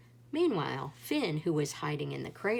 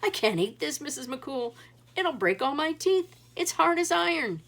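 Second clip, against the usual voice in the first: higher, louder outraged complaint; loud indignant whine; complaining yell; 8 semitones higher, 5 dB louder than usual. A narrator's reading. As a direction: protest about his teeth. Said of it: "he yelled".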